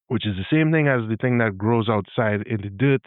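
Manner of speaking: usual speaking voice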